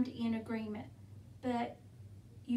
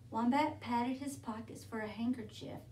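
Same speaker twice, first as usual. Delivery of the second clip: plain reading voice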